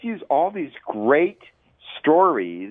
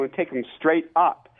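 usual speaking voice

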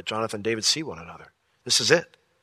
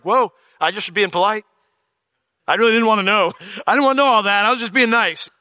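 exasperated polite person; 9 semitones higher, 7 dB louder than usual. Acted. Acted reluctant small-talker.

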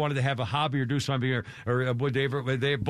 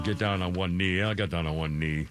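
much lower, deadpan mock suitor; mock proposal; 11 semitones lower than usual. Mocking the proposing bachelor, much lower and deadpan. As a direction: drone it like a bored groom. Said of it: "mocking proposal again".

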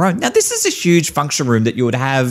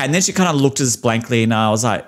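ordinary talk, nothing marked

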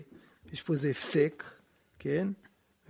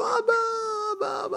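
a son crying up to the window, high-pitched; the son calls up to the window to his father, high and pleading; calling out to father. Retold or imitated. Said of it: "הוא צועק לו".

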